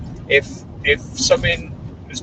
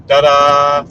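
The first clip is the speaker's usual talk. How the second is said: mock fanfare; 2 semitones higher, 9 dB louder than usual.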